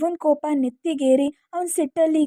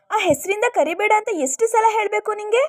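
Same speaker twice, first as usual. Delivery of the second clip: put-on higher, irritated young girl's voice; annoyed girl; 7 semitones higher than usual. Khushi tells Dhruva off, higher and irritated. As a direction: snap back like a fed-up girl.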